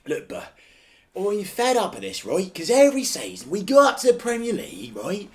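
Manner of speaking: ranting Londoner, high and loud; London accent. Retold or imitated. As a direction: rant like a fed-up London fan. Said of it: "That's got a bit London again".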